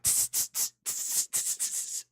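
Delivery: sharp unvoiced tss hisses like a dog trainer; dog whisperer hiss. Imitating the dog whisperer correcting a dog with a tss hiss. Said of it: "the dog whisperer used to, like, go like".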